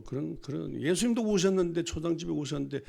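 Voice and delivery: normal talking voice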